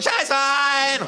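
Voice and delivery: long, very high, loud yell; kangaroo scream. Acted. Kangaroo joining in, drawn-out scream. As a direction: scream it out, stretching the word. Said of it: "ruft das Känguru".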